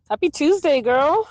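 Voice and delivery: cheery greeting. Real, not acted.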